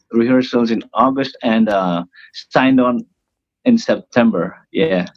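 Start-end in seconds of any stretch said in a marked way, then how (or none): none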